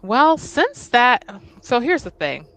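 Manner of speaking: ordinary talk, nothing marked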